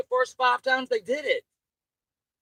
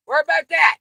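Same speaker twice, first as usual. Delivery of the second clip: higher, louder repeat of "Worry about that."; louder angry repeat; 7 semitones higher, 8 dB louder, faster than usual. Real, not acted. Ranting at Sam, repeating it with a higher, louder furious shout. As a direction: drive it home with a raised voice.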